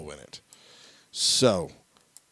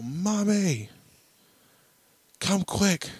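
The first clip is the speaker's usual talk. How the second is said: calling out; 8 semitones higher than usual. Retold or imitated. Yelling out of the bathroom.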